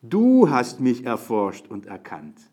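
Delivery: du hast mich erforscht und erkannt slow and loud, du punched; stress on du. Shown as a demonstration. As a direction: lean on du, slow and emphatic. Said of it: "das ist die erste Betonung".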